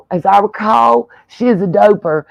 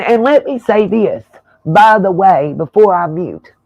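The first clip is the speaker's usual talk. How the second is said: ordinary delivery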